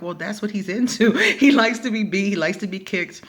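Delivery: answering the roommate, laughing. Retold or imitated. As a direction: shrug it off with a laugh. Cheeky reply, laughing.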